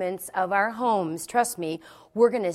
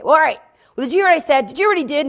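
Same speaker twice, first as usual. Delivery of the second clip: loud, high, agitated phone rant; acted outburst, loud and high-pitched; irate demand; 8 semitones higher, 14 dB louder, much faster than usual. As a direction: imitate an exasperated caller pressing for agreement. Sister's irate phone rant retold.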